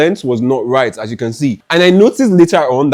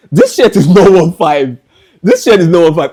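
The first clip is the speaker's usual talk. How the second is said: emphatic correction; 5 semitones higher, 7 dB louder than usual. Real, not acted. Stressing the real price.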